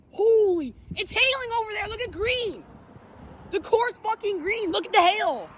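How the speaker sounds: high-pitched thrilled voice; excited. Real, not acted. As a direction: point it out breathlessly. Hail core in sight.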